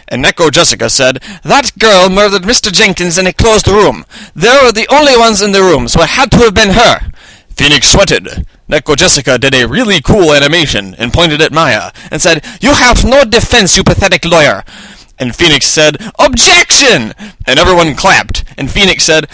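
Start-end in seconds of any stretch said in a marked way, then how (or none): 1.4-7.1 high-pitched, put-on Russian accent. A narrator's reading. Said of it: "had a Russian accent". 12.6-14.6 scornful, high Russian villainess; Russian accent. A narrator's reading. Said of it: "had a Russian accent". 16.2-17.2 high, dramatic lawyer's cry; courtroom objection. A narrator's reading.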